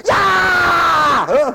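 long, high, loud yell; pent-up angry scream. Acted. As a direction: explode in a mock scream. Pent-up anger let out at home.

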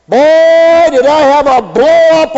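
loud, high-pitched grumbling church member; mock churchgoer grumbling.

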